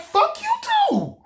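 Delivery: very high, drawn-out mock yell; hammed-up, high-pitched retort; retold angry comeback. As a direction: squeal the curse right back. Acts out his cursing back.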